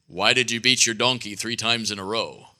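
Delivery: lightly voiced godlike questioning. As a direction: speak low as a solemn angel. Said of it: "in her best godlike voice, she said".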